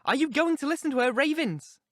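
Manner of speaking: high, vehement outburst; agitated scolding; passionate. A narrator's reading. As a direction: demand it passionately, pitched high. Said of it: "I said passionately".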